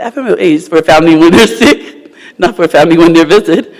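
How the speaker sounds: amused scolding of husband; laughing correction. Retold or imitated. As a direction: explain it through laughter.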